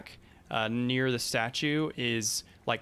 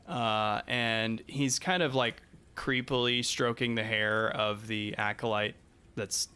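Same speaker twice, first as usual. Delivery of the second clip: ordinary talk, nothing marked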